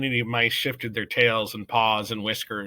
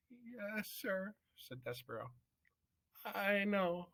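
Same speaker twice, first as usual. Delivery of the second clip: higher, soft little mouse voice; young mouse meekly answering the judge; small Despero, meek; 10 semitones higher, 10 dB quieter, slower than usual. Acted. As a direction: answer timidly, much higher.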